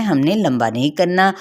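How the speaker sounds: ordinary talk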